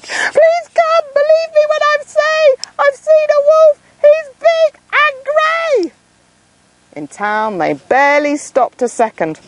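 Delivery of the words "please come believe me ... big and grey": high pleading shriek, slowing at the end; shriek for help. Acted. Boy shrieking to town about wolf, high and pleading. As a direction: beg the town to believe you. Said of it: "heard to shriek".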